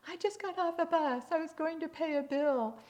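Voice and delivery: put-on high, loud old lady voice; upset old lady. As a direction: complain loudly as an indignant little old lady. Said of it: "this little old lady who's really upset".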